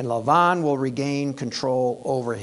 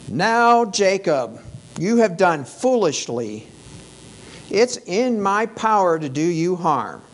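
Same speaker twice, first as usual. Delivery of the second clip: loud, stern threat, higher; threatening; 4 semitones higher, 5 dB louder than usual. Retold or imitated. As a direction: warn him coldly, voice raised. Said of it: "He uses threats".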